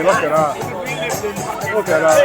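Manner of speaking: usual speaking voice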